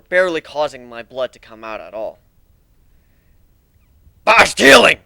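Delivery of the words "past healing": loud, higher growled cry. Acted. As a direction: bark it out in frustration. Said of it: "growled Bark".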